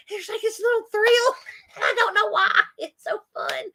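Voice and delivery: high, laughing through the words; giggly delight. Real, not acted. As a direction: talk through real gleeful giggles. Giggling at the thrill.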